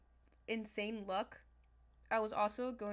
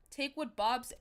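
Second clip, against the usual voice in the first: ordinary delivery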